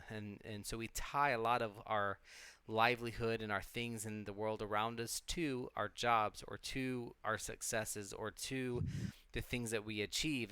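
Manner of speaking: usual speaking voice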